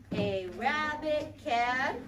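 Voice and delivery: stretched slowly word by word; slow sentence read. Shown as a demonstration. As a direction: spell out "A rabbit can" slowly for the class. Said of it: "we can say it in a sentence".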